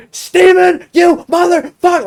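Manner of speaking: very high, loud imitation of furious mom; mad hollering. Mom in the shower catching her son, mad hollering. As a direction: holler the name in outrage. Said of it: "straight, like, hollering at me".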